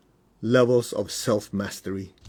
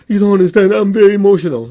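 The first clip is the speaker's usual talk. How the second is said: higher, louder mock self-pity; mock emotional student; 7 semitones higher, 9 dB louder than usual. Students insisting to the teacher, mock whiny. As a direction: whine it like a dramatic student. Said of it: "they go".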